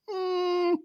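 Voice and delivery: high, thin "mm" of discomfort; doubtful high-pitched hum; uneasy whine. Acted. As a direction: squirm vocally on a held high hum. Wordless uneasy noise about mind magic.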